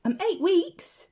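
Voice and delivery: a tiny owl proudly giving his age; earnest owlet. A narrator's reading. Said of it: "said Plop".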